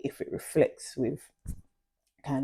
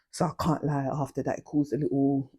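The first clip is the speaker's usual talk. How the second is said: ordinary talk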